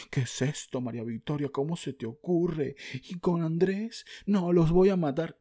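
jealous husband outburst. Acted. Cheated husband confronting wife and friend, mock furious outburst. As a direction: play the cheated husband losing it.

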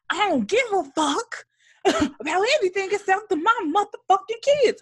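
high-pitched imitation of her outburst; defiant mock outburst. Acts out woman's defiant outburst.

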